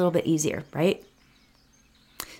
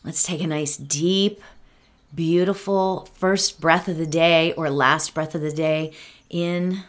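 guided meditation; 6 dB quieter than usual. Acted. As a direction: ease them into calm, one breath at a time.

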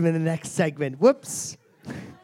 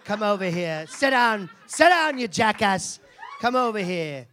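mock angry; 4 semitones higher than usual. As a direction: bark him back into his seat. Host berating volunteer.

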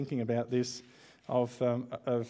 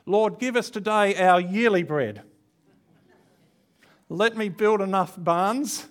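mock greedy prayer; 6 semitones higher, 7 dB louder than usual. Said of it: "this is how I'd like to pray".

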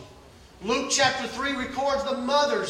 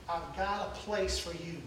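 hushed, slow, breathy voice of God; tender whisper; 5 semitones lower, 8 dB quieter, slower than usual. Acted. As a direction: lean in and whisper it gently.

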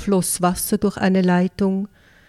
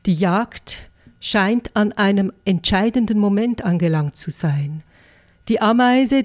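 usual speaking voice

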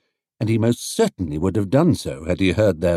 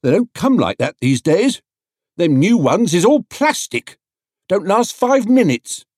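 higher, louder onlooker voice; a gruff market onlooker, pitched up; 9 semitones higher, 4 dB louder than usual. A narrator's reading. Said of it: "agreed an onlooker".

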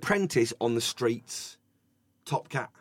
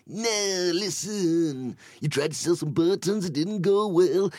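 [name] impression; 4 semitones higher, 3 dB louder than usual. [name] voicing Top Cat, a hint of mock talk-singing. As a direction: lightly mimic a folk legend talking. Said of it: "[name] would voice Top Cat".

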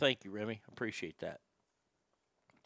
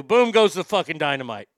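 loud catchphrase; 6 semitones lower, much faster than usual. Shown as a demonstration. Podcast host proving he does talk that way, booming. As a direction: boom goes the dynamite, belted out loud. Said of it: "Oh, wait, I do".

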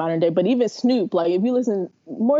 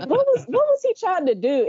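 very high, incredulous repeat; baffled friend; 12 semitones higher than usual. Retold or imitated. Friend from the Bay questioning the actor's accent, baffled. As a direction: stumble on the question in mock disbelief.

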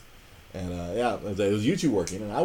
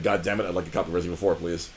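imitating a humiliated customer, low and rushed; grudging request; 7 semitones lower, much faster than usual. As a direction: get it out fast through wounded pride. Retold humiliated customer forced to repeat.

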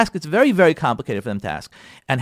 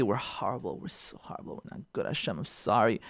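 mock penitent murmur; 8 semitones lower, 13 dB quieter than usual. Mock confession to Hashem, said lightly.

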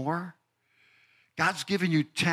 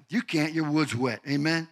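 usual speaking voice

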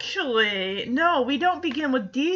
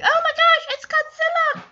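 very high, loud shrieking chick panic; panicking chick; 4 dB louder than usual. Retold or imitated. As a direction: scream like a chick being swallowed by Godzilla. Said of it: "probably thinks, like".

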